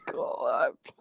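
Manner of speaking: slow, deep imitation. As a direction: drop to a rumbling low register. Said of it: "let's get [name] on the pod".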